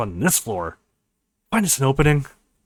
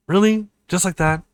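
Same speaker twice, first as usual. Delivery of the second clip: higher, louder really; startled, delighted question; surprised; 5 semitones higher, 4 dB louder than usual. Acted. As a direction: pop up in surprise. Reacts to bride's easy answer.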